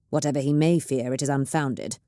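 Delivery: ordinary delivery, nothing marked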